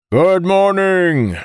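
loud, high, drawn-out hail; booming voice. A narrator's reading. Naval officer greeting a crowded galley, booming. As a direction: boom it out across the room. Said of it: "said in a booming voice".

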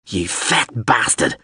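slow, venomous curse; angry hiss. A narrator's reading. Abandoned crewman curses the pilot, slow spiteful hiss. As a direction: draw the insult out with spite. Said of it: "Lamb hissed".

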